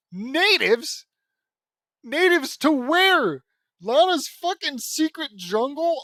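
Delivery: scandalized shout at the story; outraged yell. Real, not acted. Outraged at the word.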